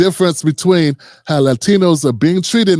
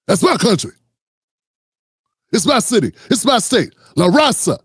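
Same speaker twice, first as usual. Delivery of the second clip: tough guy voice. Retold or imitated. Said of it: "That's how these Latinos sound".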